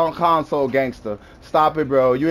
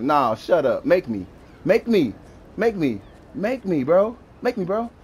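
usual speaking voice

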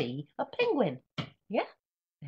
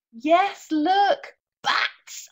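much higher and louder, delighted; excited reveal; 12 semitones higher, 11 dB louder, much slower than usual. Acted. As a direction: cry it out like a discovery. Reveal of page.

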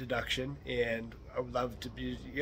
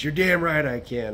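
loud, higher, emphatic comeback; retold angry retort; 4 semitones higher, 10 dB louder than usual. Neighbor snaps back at the host in argument, retold anger. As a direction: throw it back hard as the riled neighbor.

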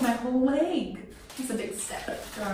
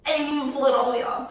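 ordinary talk, nothing marked

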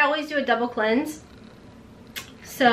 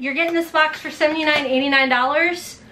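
ordinary delivery

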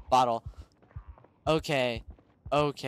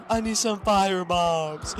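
ordinary talk, nothing marked

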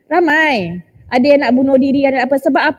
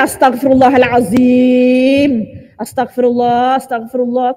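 low, drawn-out astaghfirullah; hammed-up disapproving piety; mock pious shock; 4 semitones lower, slower than usual. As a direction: intone it slowly, as if scandalised.

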